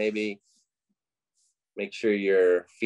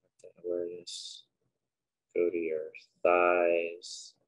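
unhurried, quiet cues for breath and body; guided meditation; 3 dB quieter than usual. Acted. Leading listeners through breathing and a body scan, guided meditation.

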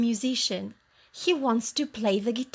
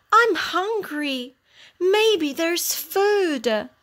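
high, slow, hopeful dog; dog voice yearning for a meal; hungry dog; 7 semitones higher, 4 dB louder, slower than usual. A narrator's reading. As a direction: draw it out longingly in a raised pitch. Hungry dog hopes for food.

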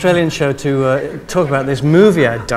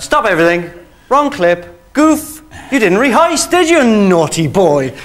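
higher, louder camp telling-off; teasing scold; 6 semitones higher, 6 dB louder than usual. Retold or imitated. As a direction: wag a finger at the naughty host. Teasing the host on air.

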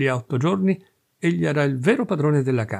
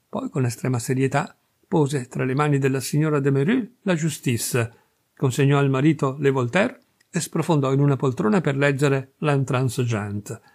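ordinary delivery

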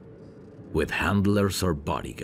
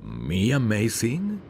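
higher, louder, slow amused surprise; amused disbelief; 9 semitones higher, 4 dB louder, slower than usual. Acted. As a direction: turn the compliment back, amused. Listener compliments him back.

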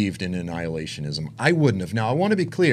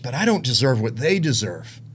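normal talking voice

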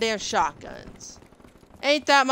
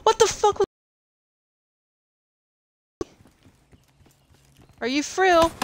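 high, incredulous cry; can't believe it just happened; outraged disbelief; 11 semitones higher, 3 dB louder than usual. Real, not acted. Killed again, outraged disbelief.

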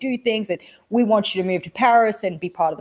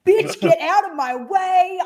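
high, slow strutting boast; mock-cocky victory strut; hyped-up swagger after a job offer; triumphant swagger; 10 semitones higher, slower than usual. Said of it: "I'm walking like".

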